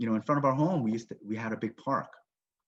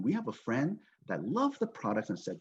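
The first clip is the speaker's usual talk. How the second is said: usual speaking voice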